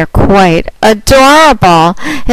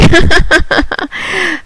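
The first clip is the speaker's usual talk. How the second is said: high giggle; 10 semitones higher than usual. Real, not acted. Laughs after praising caller.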